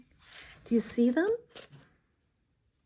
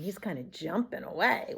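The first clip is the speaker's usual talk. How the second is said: plain reading voice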